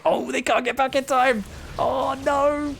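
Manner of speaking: high, anguished oh no; dismayed cry. Real, not acted. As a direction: groan it out as the play fails. Dismay as units die.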